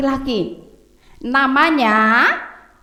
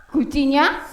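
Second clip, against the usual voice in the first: usual speaking voice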